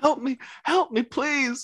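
very high, slow hammed-up whimper; mock pleading. Friend teasing him with a high, pleading mock voice. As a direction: beg for help like a frightened child, mocking. Friend mocks the scared call.

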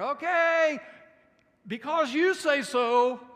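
slow it down and drip with sarcasm; sarcastic. Acted. Preacher voicing Peter, sarcastic at Jesus' order. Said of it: "I try to hear a little sarcasm".

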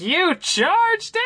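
a very high, slow, stretched-out squeak of disbelief; shocked disbelief. Retold or imitated. Dad hearing his son charged the jacket, high incredulous squeak. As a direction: drag the question out in stunned outrage. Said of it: "He's like".

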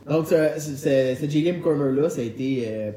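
ordinary talk, nothing marked

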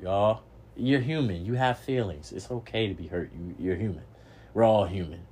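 usual speaking voice

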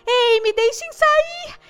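high, loud, drawn-out plea; shout. A narrator's reading. Trapped girl yelling to be let out, long shout. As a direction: yell to be let out.

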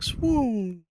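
high, dreamy swoon. Retold or imitated. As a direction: swoon like a lovestruck fan. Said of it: "She'd be like".

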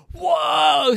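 high, loud gasp of amazement; amazed child's shout; astonished whoa. Acted. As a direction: blurt it in disbelief. Boy shocked the robot talks.

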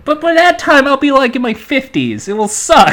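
high, loud laughing whine; laughing complaint. Real, not acted. Groaning about waiting decades.

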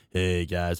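low, soft mock impression of a friend; subdued, understated greeting in a friend's voice; Scott. Shown as a demonstration. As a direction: drop it low and quiet, lightly imitating a pal. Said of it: "He's just kinda like".

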